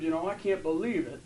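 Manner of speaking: ordinary talk, nothing marked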